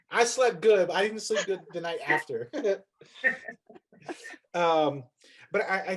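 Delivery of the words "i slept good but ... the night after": laughing. Real, not acted. Laughing joke about sleeping.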